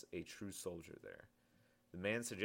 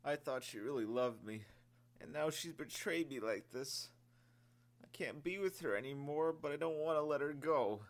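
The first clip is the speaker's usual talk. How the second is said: put-on higher, sobbing young voice; 7 semitones higher than usual. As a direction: sob it out, heartbroken. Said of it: "the sergeant sobbed".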